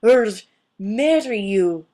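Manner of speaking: loud, slowing angry lizard; livid outburst. Acted. The livid lizard snarls at Martina. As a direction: let the fury slip out.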